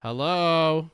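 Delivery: loud, high, drawn-out "hello"; acted wake-up call; incredulous hello. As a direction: call out as if nobody is noticing. Imagined sane relative calling out.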